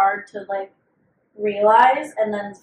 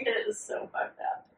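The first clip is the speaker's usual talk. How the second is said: whispered agreement; 8 dB quieter than usual. Real, not acted. To her cohost after the penis story, a shocked whisper. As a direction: whisper it, half laughing in shock.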